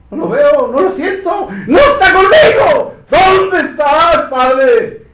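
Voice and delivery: high, loud, tearful shout, slowing at the end; crying out forsaken. Acted. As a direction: cry it out like an abandoned son. Preacher acts Christ's forsaken cry.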